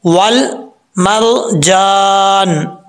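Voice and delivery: slow, drawn-out chant; Quranic recitation. Shown as a demonstration. Teacher reciting the next words, slow chant. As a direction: draw out वल Marjan like a recitation. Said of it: "recites the next word".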